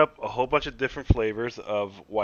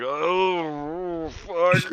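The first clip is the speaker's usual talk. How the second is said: long drawn-out mock groan, pitched up; waking up hungover; 6 semitones higher than usual. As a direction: groan as if waking still drunk. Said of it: "You wake up like".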